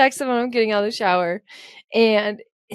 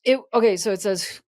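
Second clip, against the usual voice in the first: ordinary delivery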